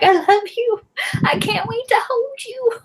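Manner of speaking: put-on high, tearful baby talk; aunt cooing to her newborn godson, high and teary. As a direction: coo at the newborn you adore. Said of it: "I'm just like".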